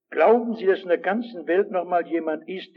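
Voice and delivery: ordinary talk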